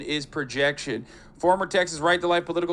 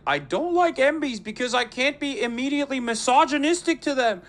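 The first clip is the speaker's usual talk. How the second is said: mock Peterson quote; 6 semitones higher than usual. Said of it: "he's like".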